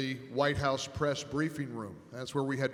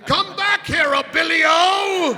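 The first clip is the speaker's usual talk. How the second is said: much higher, slow, much louder mock shout; Gorka bellowing; 14 semitones higher, 13 dB louder, slower than usual. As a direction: drag out each word as a furious bellow after him. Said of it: "hear Gorka bellowing".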